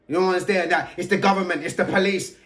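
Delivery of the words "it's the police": ordinary talk, nothing marked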